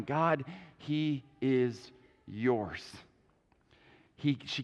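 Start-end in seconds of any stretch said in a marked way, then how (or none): 0.0-2.8 put-on slow, hushed prayer of giving up a child; quiet surrender. Hannah gives her son to God in a slow, quiet prayer. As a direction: let it go gently, slowly. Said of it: "it all started with Hannah going".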